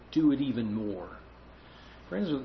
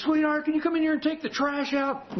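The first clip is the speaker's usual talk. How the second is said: higher, lightly voiced wife calling out; 12 semitones higher than usual. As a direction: call sweetly from the kitchen. Wife calls from kitchen.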